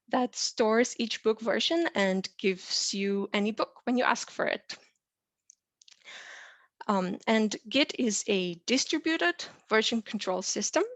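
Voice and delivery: ordinary delivery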